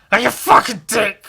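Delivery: shouting about getting nothing back; indignant yell. Acted. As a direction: bellow it like a wronged man. Repeats line, second time yelled.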